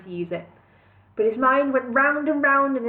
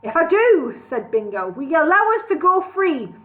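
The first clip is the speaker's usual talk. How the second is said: loud plea; 7 semitones higher, 5 dB louder than usual. A narrator's reading. Hero bargains loudly.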